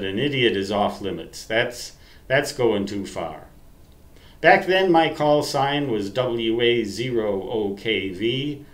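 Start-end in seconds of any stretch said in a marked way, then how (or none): none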